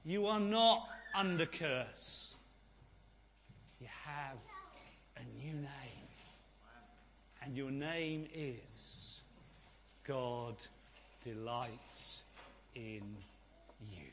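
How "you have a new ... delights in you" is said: much softer, slow, with long pauses between words; a soft, intimate whisper-close of the new name; tender hush. Real, not acted. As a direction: lay each word down gently, like a blessing.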